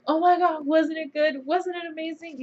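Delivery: high, loud gushing over the show; retelling her own excited reply. Retold or imitated. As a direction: squeal it like a thrilled fan. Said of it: "I'm like".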